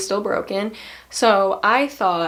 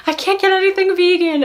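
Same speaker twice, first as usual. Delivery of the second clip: high, louder mock coworker voice; coworker's voice; 11 semitones higher, 5 dB louder than usual. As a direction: play the flustered coworker, pitched way up. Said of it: "she was like".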